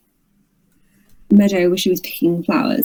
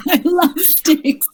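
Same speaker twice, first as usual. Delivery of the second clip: laughing; 7 semitones higher, much slower than usual. Acted. Players joke, laughing, slowed.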